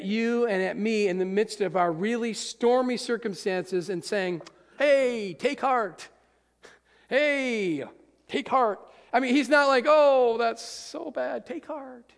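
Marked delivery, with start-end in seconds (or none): 4.8-8.8 fluffy take heart. Retold or imitated. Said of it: "It's not a fluffy statement". 9.9-12.1 limp pity. Retold or imitated. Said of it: "It's nothing like that".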